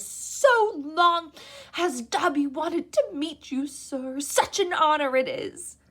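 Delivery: high, loud, slow elf voice; eager house elf. A narrator's reading. House elf meeting Harry, high and eager. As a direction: gush like an awed little elf.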